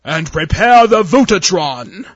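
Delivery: slow, very loud evil command; villainous shout. Acted. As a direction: bellow the order triumphantly. Admiral's final villainous order.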